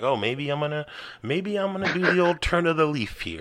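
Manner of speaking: hammy put-on Italian accent; fake Italian accent. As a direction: play a cheesy Italian guy. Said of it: "fake Italian impre-, impressions".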